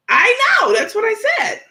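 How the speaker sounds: high, loud excited cry; excited shout. Real, not acted. Excited agreement.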